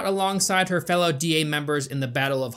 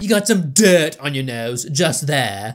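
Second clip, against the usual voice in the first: louder, condescending put-on voice; snooty know-it-all; 3 semitones lower, 4 dB louder than usual. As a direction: point it out condescendingly. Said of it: "little stuck-up asshole".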